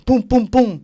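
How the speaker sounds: three loud, punchy thumps; imitated hard taps. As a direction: voice three hard pokes on the shoulder.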